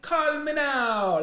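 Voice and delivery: high, drawn-out Jamaican psychic's cry; loud mock-Jamaican TV pitch. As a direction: stretch it out in a hammed-up Jamaican accent. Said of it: "like, really stereotypical".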